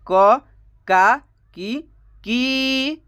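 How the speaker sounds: "क", "का", "की" each said alone with short pauses; syllables spelled out. Shown as a demonstration. Teacher showing students how "क" changes to "की", clearly spelled out. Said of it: "देखिए दोस्तों कैसे उच्चारण बदल रहा है".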